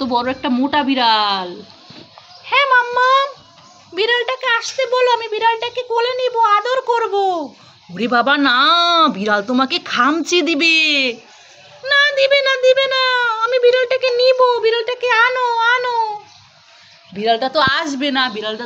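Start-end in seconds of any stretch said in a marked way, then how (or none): 2.4-7.1 put-on high, pleading little-kid voice; child voice. Child asking mummy to bring the cat, high and pleading. 11.8-16.2 put-on high, whiny kid insisting; a small child who won't take no; insistent child. Child insisting to mummy the cat won't scratch, high and whiny.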